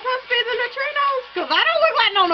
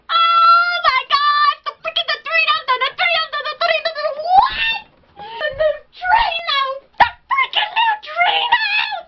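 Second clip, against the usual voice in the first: high, slow fan yells; excited yelling; 8 semitones higher, slower than usual. Real, not acted. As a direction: yell it out in delight. Fan excitement over neutrinos.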